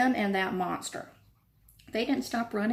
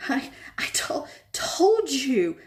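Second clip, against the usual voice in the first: breathless, out of breath whisper; gasping; 6 semitones higher, 4 dB louder, slower than usual. A narrator's reading. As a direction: gasp the words out after running. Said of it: "Hermione gasped".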